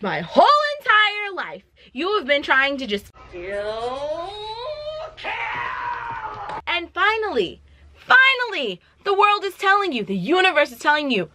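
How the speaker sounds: high, theatrical gloating tirade; hammed-up triumphant outburst; mock vindicated rant. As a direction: act out a long-awaited told-you-so. Gamora's gloating rant, acted out.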